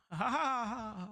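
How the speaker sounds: loud, high burst of laughter; laughing. Real, not acted. Laughing at his own song.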